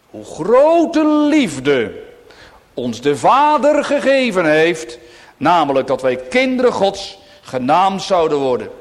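fervent scripture cry; exalted proclamation. Real, not acted. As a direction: stretch out the words in awe. Reading text with full fervour.

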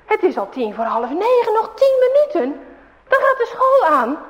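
high, loud hurrying mother; a storyteller's busy mum; mother scolding. A narrator's reading. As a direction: chase the boys out the door. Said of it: "zegt moeder".

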